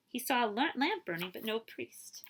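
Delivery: ordinary delivery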